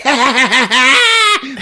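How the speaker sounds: high, loud crazed cackle; hammed-up rising laughter; maniacal laugh. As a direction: cackle like a gleeful villain. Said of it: "And he's like".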